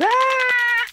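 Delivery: very high mock scream; imitated movie scream. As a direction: imitate a woman screaming in a horror film. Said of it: "screaming movies where the woman goes".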